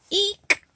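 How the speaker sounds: shrill startled eek; little squeal; high squeal. Acted. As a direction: squeak at the touch. Itch reaction.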